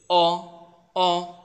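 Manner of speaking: "অ" as a held open vowel; teacher sounding out "অ" distinctly; pronunciation demo. Shown as a demonstration. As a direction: model the Chinese e as "অ". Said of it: "ওইটার উচ্চারণ হচ্ছে ই এর উচ্চারণটা অ".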